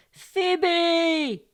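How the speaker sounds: high, loud, stretched-out cry; sudden high sleeper's shout; shout in sleep. A narrator's reading. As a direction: call the name out as if dreaming. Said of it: "shout in his sleep".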